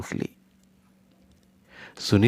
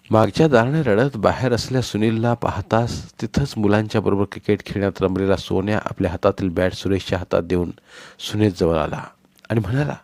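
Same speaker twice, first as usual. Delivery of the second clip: usual speaking voice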